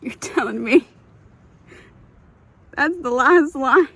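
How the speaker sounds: high and loud, tearful disbelief; outraged tears at the ending; crying disbelief. Real, not acted. Reader in disbelief at the book's last line, crying loudly.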